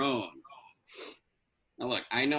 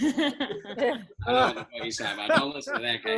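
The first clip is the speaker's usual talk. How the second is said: nervous laugh; 11 semitones higher, 5 dB louder than usual. Acted. Distances himself, nervous laughing.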